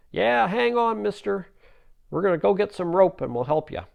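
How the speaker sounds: put-on high, loud boyish shout; calling down. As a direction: holler down from a clifftop. Boys call down to man.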